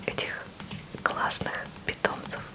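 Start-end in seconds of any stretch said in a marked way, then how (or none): none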